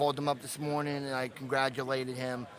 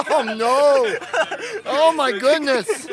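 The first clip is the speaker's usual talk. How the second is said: startled laugh; 12 semitones higher, 13 dB louder, much slower than usual. Real, not acted. Spider found on host's head.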